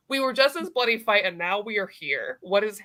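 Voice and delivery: ordinary delivery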